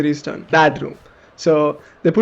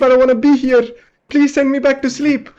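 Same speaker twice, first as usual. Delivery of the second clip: even higher, cracking plea; more desperate pleading; 7 semitones higher than usual. Retold or imitated. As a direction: beg harder, near tears. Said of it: "And then I'm like".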